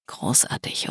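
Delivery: soft whisper into her ear. A narrator's reading. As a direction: breathe it close to the ear. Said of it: "flüsterte er mir ins Ohr".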